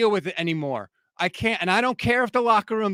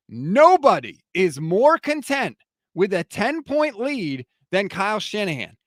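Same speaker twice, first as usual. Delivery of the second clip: normal talking voice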